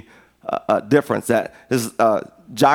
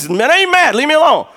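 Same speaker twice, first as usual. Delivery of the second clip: high, loud, huffy protest that he is not mad; mock irritated denial; 11 semitones higher, 5 dB louder, faster than usual. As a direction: play it as a defensive snap, much higher and louder. Preacher acting out his own irritated reply.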